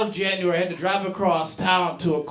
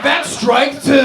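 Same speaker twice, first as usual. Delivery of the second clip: stern warning; 5 semitones higher, 8 dB louder, much slower than usual. Retold or imitated. Coworker scolds him.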